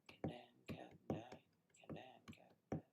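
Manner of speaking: playful chant sinking to a whisper. Pretend. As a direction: whisper the rhyme as it fades.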